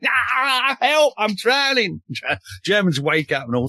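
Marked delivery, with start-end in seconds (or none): 0.0-2.0 high, slow hammed-up cry for help; mock drowning shout. Historian retelling a Cockney falling in the water: high hammed-up cry for help. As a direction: play a soldier floundering in the river, yelling. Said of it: "falls in the water and starts shouting".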